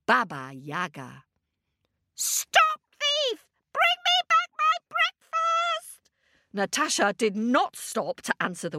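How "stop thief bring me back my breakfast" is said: high shrill-pitched witch cry, much higher; witch yelling. Acted. Baba Yaga yelling after Natasha in a high witch voice. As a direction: screech after the thief like a witch.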